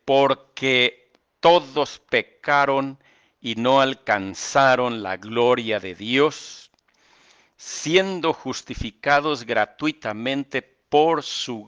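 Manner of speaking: forceful sermon reading, slow and loud; preached scripture reading. Acted. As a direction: proclaim the verse to the congregation. Reads scripture emphatically.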